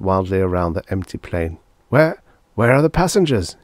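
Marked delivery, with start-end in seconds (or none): 1.9-3.6 higher, louder, stammered croak; alarmed croak. A narrator's reading. Lawyer in the empty plane, croaking in alarm. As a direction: croak it out with growing suspicion. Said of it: "he croaked".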